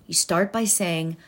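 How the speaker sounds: ordinary talk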